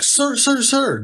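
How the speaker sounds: high, insistent calls of sir; urgent stranger calling sir sir sir. Retold or imitated. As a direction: call up to the man on the porch, pitched high.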